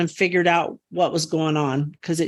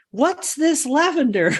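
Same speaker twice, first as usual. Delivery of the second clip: higher-pitched imitation of a curious tour visitor; 8 semitones higher than usual. Visitor asking the gardener on the tour, curious. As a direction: ask it high, as an inquiring visitor.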